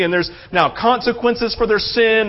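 ordinary delivery

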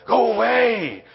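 barked mock shout at the families; a hammed-up mean old disciple shooing people off. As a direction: disciple yelling go away. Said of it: "these mean old disciples".

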